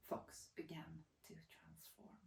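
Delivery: slow hushed whisper; slow spooky whisper; eerie whisper. A narrator's reading. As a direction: breathe it like a secret. Eerie transformation climax.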